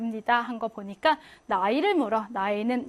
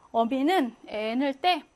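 ordinary talk

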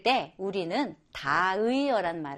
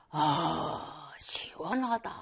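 low, soft sigh of bath relief; contented bath sigh; 5 semitones lower, 7 dB quieter, much slower than usual. Retold or imitated. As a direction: sink into hot water and sigh. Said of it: "이래요".

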